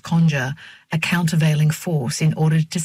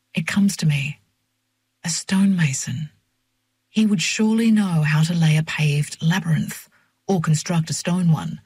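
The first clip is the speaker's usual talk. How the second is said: plain reading voice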